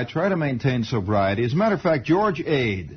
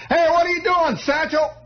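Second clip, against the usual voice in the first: hollering. Retold or imitated. Cousin Buddy calling down from the window, very high. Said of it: "he hollers out of the window".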